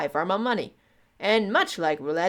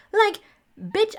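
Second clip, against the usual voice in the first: exasperated; 13 semitones higher than usual. Real, not acted. Exasperated retort.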